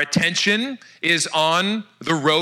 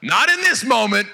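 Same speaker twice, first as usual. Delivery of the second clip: laughing; 4 semitones higher than usual. Real, not acted. Laughs after mock voice.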